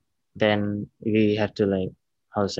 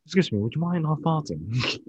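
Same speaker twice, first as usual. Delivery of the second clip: higher put-on doctor voice, prim and rising; mock doctor, repeated; 7 semitones higher than usual. Improv doctor repeating himself to the patient, higher prim doctor voice. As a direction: repeat the complaint pitched up, fussy doctor. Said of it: "I'm the doctor".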